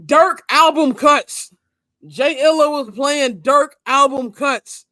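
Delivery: loud, slow and punched out; hyped emphasis. Real, not acted. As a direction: belt it out with hype, stretching the words. Hyped recalling music at game.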